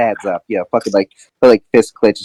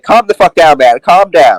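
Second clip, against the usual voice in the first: loud, raised-pitch command; urgent restraint; 7 semitones higher, 5 dB louder than usual. Acted. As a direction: shout him down while holding him back. Pulling back a friend in a fight.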